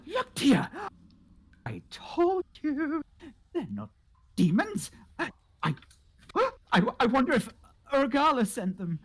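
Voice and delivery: high, wondering hermit woman voice; eccentric cave woman, excitable. Acted. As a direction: chatter high and excitedly to a toad, welcoming a visitor. Voices cave woman meeting gnome.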